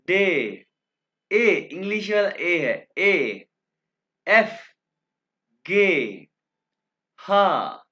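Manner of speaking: each German letter called out loud and clear; slow letter-by-letter demo. Shown as a demonstration. Teacher reciting the alphabet to students, loud and clear. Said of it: "अब मैं आपके सामने alphabets को दो दफ़ा pronounce करूंगा".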